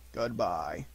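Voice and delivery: low, soft donkey farewell; Eeyore. A narrator's reading. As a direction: say goodbye low as a downcast donkey. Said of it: "said Eeyore".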